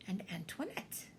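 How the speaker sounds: hushed whisper; whispered reveal. A narrator's reading. Narrator revealing the mismatched puppy in a whisper. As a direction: drop to a secret whisper on the name.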